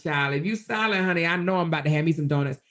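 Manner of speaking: normal talking voice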